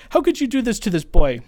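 loud, high indignant scolding; mock yell. Defending girls scold the laundry thief loudly. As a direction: throw the outrage at her. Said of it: "the girls defending me were, are going".

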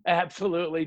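ordinary talk

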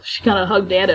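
ordinary delivery

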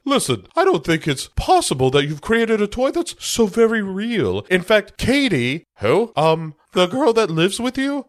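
high, prim social worker lecturing; mock official explaining to the guardian. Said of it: "is like".

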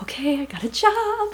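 high, eager guess; bright little squeak of certainty; excited. Retold or imitated. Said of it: "And I'm like".

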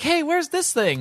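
high, drawn-out mock woman's voice; lady's voice. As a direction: imitate a lady calling from the background. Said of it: "you hear this lady's voice in the background".

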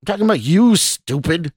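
loud, high, cutting insult; jeering, forceful retort; snarl. A narrator's reading. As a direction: snarl it, driving up in volume. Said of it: "the stranger snarled".

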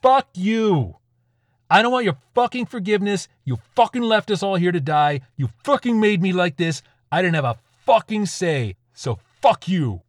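high, loud rant at God; enraged tirade, slowing hard on the last words; furious scream. A narrator's reading. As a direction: scream your rage at the heavens. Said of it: "screamed at the ceiling of the bunker".

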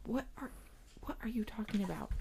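very soft, almost whispered puzzled question; hushed bafflement. Retold or imitated. Parent answering an angry girl, quietly baffled. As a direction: ask it under your breath, confused.

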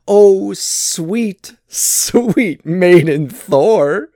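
high, loud, drawn-out cooing; mock-sweet teasing. A narrator's reading. Loki as bridesmaid teasing bride Thor, mock-sweet. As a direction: croon it with exaggerated sweetness.

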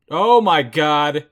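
slow, loud, stunned oh my God; shocked gasp. Real, not acted. Viewer watching the lights go out, loud stunned surprise. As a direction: caught off guard by the screen going red. Lights go red, abduction scene.

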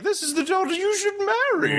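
mock presenter. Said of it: "they first put out there as like".

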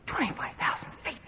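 mock-horrified whisper; shocked whisper. Passenger hears of the drop, aghast stage whisper. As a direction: whisper it in acted disbelief.

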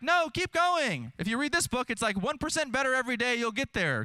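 voice of perseverance. Retold or imitated. Perseverance urging a tired believer to keep going, high and upbeat. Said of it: "perseverance would say".

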